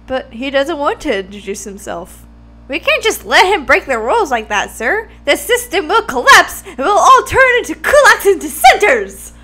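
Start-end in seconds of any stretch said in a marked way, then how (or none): 2.7-9.3 loud, frantic and high; building rant; excited rant. Acted. Classmate ranting about rules.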